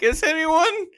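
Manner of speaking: high, pleading whine; insecure poet. Retold or imitated. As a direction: beg desperately, voice cracking. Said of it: "he was like".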